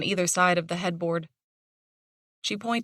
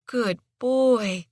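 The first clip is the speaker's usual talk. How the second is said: higher, gentle praise; soothing praise; 4 semitones higher than usual. A narrator's reading. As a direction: reassure him warmly. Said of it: "soothing tone".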